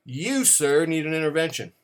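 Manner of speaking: ordinary delivery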